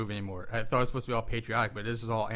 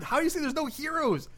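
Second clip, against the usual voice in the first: high, indignant rant; fed-up outburst defending soldiers; heated rant; 11 semitones higher than usual. Real, not acted. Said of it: "I'm really getting heated".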